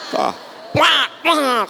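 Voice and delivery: high, hammed-up quarrel babble; high mock argument; mock fight noise. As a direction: mimic a shouting match. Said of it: "Kan gitu".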